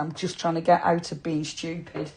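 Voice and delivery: normal talking voice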